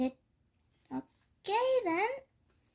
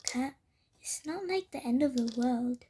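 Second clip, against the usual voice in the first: normal talking voice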